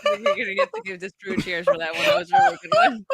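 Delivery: high, loud, laughing praise; helpless laughter. Real, not acted. Co-host cracking up at a friend's joke, helpless laughter. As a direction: squeal it through the laughter.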